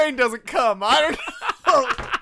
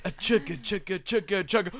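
train chant; 4 semitones lower, 6 dB quieter than usual. Pretend. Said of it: "Why did you both do that?".

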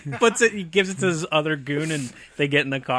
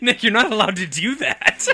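raised pitch, cracking up while telling him off; laughing reprimand; 5 semitones higher than usual. Real, not acted. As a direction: scold through laughter. Laughing scolding after friend's bit.

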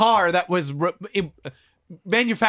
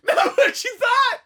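laughing repeat; 4 dB louder than usual. Real, not acted. Repeating line amid laughter.

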